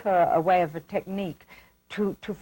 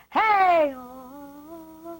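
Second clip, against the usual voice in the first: imitated tiny little voice; 7 semitones higher than usual. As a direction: mimic a singer's voice spiralling back inside. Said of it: "it was a very tiny little voice".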